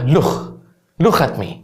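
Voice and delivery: "لوخ" ending in a throaty kh; Scouse k as kh. Shown as a demonstration. Teacher showing how Liverpool says look, "لوخ" with final kh. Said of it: "بصوت الكي بتتحول لخ".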